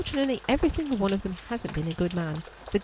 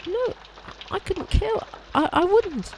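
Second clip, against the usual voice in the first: high, frightened protest; panicked denial; 11 semitones higher, slower than usual. Acted. Accused maid denying the killing, high and panicked. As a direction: squeak out your innocence in panic.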